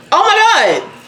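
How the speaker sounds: high-pitched "oh my God"; acted-out alarm with some amusement; retold alarm. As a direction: exclaim it high, reliving the shock. Retelling alarm at finding blood clot.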